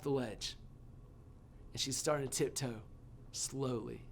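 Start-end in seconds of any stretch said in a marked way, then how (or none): none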